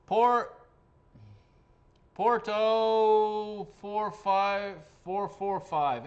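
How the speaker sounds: high, drawn-out shout; big slow game-show holler; loud announcer call. Real, not acted. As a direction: belt out the winning entry, stretching it. Calling out a winning entry.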